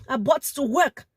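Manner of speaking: usual speaking voice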